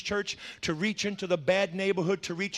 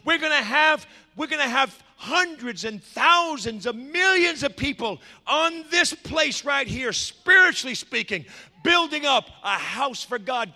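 raised, fervent sermon voice; full-force preaching; 4 semitones higher, 5 dB louder than usual. Real, not acted. Preacher casting the vision of God's house, fervent preaching. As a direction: proclaim it to the church with ringing emphasis.

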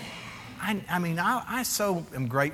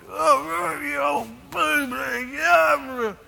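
much higher, louder mock yell with bleeped words; cussing coach; 11 semitones higher, 3 dB louder, much slower than usual. Typical youth coaches shouting at players, mock rant. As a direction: play an angry coach swearing. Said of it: "they're like".

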